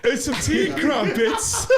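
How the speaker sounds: slow, high hammed-up British voice, laughing; mock English accent. Teased about his accent, a high laughing mock British voice. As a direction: ham up a posh Brit. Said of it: "don't ever do an English accent".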